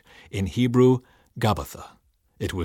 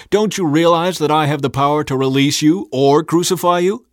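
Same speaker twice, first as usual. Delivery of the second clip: loud, higher, insistent challenge; demanding; 5 semitones higher, 7 dB louder than usual. A narrator's reading. Pilate to Jesus about his power, demanding. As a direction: press the threat with raised voice. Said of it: "Pilate demanded".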